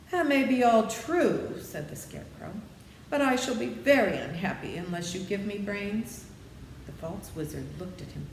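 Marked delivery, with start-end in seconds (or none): 0.1-6.2 lightly voiced Scarecrow, wistful. A narrator's reading. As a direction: plead like a floppy straw man.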